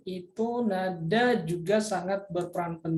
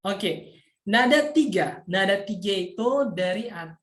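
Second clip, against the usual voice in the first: normal talking voice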